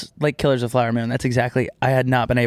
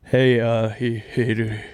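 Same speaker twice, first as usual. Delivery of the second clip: a lower, rough try at an [name] voice; [name] accent; 4 semitones lower, much slower than usual. Pretend. Still trying to find the [name] accent, lower and rough. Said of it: "I don't know why I did Fat Albert".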